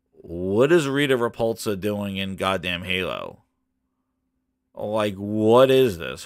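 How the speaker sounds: low, mock-dumbfounded voice; stunned disbelief. Viewer finding Rita Repulsa in Halo, low stunned disbelief.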